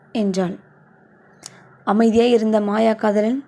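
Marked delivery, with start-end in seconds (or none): none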